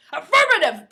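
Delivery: high, loud frustrated bark; frustrated, angry. A narrator's reading. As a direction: snap it, angry at yourself. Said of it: "frustrated and angry".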